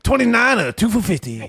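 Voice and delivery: high cartoon salesman; Mister Allen. Mimicking a commercial's price offer, high cartoon salesman. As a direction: sing out the deal in a high pitchman voice. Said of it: "and he'd be like".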